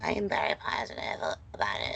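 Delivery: breathy whisper, barely audible. Real, not acted. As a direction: whisper it so no one hears. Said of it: "I'm gonna be quiet".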